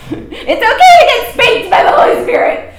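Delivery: normal talking voice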